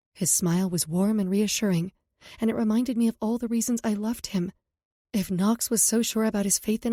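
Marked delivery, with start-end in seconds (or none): none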